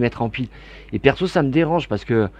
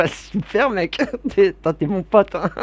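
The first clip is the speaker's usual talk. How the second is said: laughing; 7 semitones higher, slower than usual. Real, not acted. Laughing thanks to a viewer.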